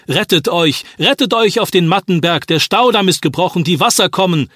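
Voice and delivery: high, loud alarm call; boy on his bike shouting the warning to every house. Acted. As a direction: cry it out across the village. Son rides through village crying warning.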